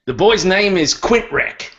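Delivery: a hammed-up tavern herald's cry; grand announcement. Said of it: "he says".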